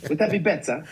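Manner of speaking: same put-on accent, breaking into a laugh; accent with laughter. Shown as a demonstration. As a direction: laugh through the teasing accent line. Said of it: "Would you like me to speak like this?".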